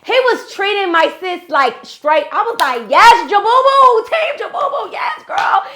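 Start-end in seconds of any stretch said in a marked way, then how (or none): 0.0-2.3 loud, high-pitched gushing; big cheerleader energy, shout it out; excited hype. Real, not acted. Telling how she was team Jabubu, loud excited hype. Hyping the man she rooted for. 2.9-5.6 very high squealed cheer; root for him like a superfan. Retold or imitated. Cheering on her friend's new man, high excited re-enactment.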